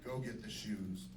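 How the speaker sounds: a low, lightly seductive request. Retold or imitated. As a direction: murmur it to your wife. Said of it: "I told her".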